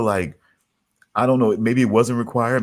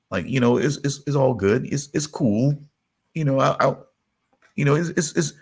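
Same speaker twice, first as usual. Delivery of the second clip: mousy, slightly high little voice; 5 semitones higher than usual. Shown as a demonstration. Friend coaching a comic's impression, mousy voice. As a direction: lift it into a meek, mousy register. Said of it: "that little mousy, you know".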